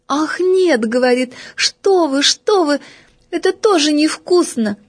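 put-on little girl voice. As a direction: fuss like a picky little girl. Голос Феньки. (капризный высокий голосок девочки)

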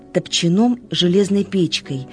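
ordinary delivery

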